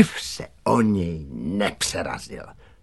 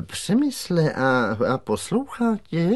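higher-pitched, hesitant question; wary director; 7 semitones higher than usual. A narrator's reading. Director asks Přemek whether the big dog obeys, warily. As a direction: ask haltingly, pitch lifted in doubt.

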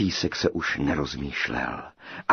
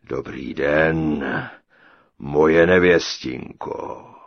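low, slow, loud giant voice; booming giant; 5 semitones lower, 6 dB louder, much slower than usual. A narrator's reading. Giant booming a greeting to his bride. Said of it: "zahromoval obr".

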